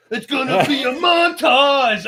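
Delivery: slow, high, belted shout; Team America quote. Retold or imitated. Quoting the Team America montage line, shouted slow and high. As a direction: belt it out like the movie song. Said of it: "the one from Team America".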